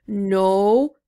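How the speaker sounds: loud stubborn monster growl; grumpy beast, dig in; booming no from the monster; monster refusing. A narrator's reading. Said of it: "said the monster".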